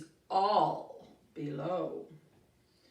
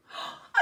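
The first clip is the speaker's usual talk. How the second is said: frightened gasp. Acted. Joey sees another troll.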